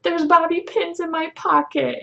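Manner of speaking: high-pitched mock tearful plea; retold crying. Crying driver confessing to the officer, mock tearful. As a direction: squeak it out as if through tears. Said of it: "get out of my mouth was crying".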